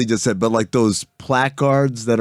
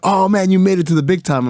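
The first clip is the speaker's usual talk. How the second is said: higher-pitched mock admiration; impressed congratulations; 7 semitones higher than usual. Radio directors congratulating a friend, imitated gushing. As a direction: gush like an impressed colleague. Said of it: "And they were like".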